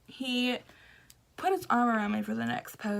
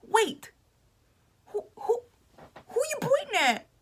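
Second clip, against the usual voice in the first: much higher, put-on questioning voice; mock puzzled celebrity; 11 semitones higher than usual. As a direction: imitate him playfully asking. Celebrity voiced in retold story.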